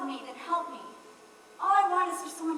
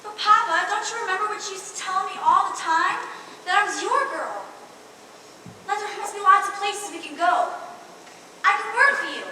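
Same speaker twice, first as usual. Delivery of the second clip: louder, quick anxious begging; a bride pleads with her father before the wedding, frantic; desperate pleading; 3 semitones higher, 6 dB louder than usual. Acted. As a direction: beg him to let her stay.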